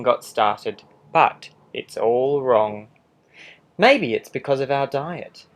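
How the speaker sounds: a lightly voiced sly wolf telling his side; charming wolf pleading innocence, animated; wolf narrator. Wolf narrating his own story.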